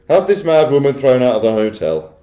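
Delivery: high, very loud command; affronted, loud and bossy; imperious Missus Jenkins. A narrator's reading. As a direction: summon help in a high, haughty voice. Wife demanding an eviction.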